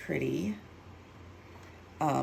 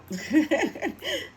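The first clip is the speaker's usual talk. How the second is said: laugh; 12 semitones higher, 5 dB louder than usual. Real, not acted. Mishap while crafting.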